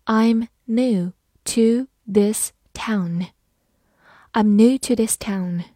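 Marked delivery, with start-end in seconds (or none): 0.1-3.3 slow, word-by-word demonstration; measured teacher model with every word separated; slow reading. Shown as a demonstration. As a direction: read it slowly, pausing after each word. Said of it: "慢慢来读".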